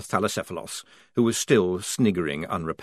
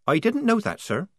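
put-on higher young soldier voice; Polly voice; 11 semitones higher than usual. A narrator's reading. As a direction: voice the young recruit.

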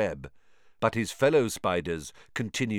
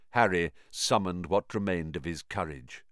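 plain reading voice

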